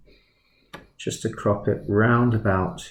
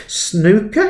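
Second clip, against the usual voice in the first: high, loud, outraged mock-Welsh question; badly faked Welsh headmaster, aghast; attempted Welsh accent; 11 semitones higher, 5 dB louder than usual. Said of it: "can't do a Welsh accent".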